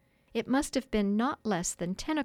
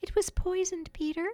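high, gentle little fairy; tender, tiny dying voice; soft fairy voice; 10 semitones higher than usual. A narrator's reading. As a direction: tell him softly, pitched way up. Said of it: "she told him softly".

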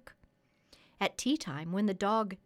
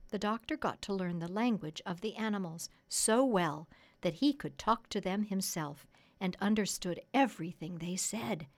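ordinary delivery, nothing marked

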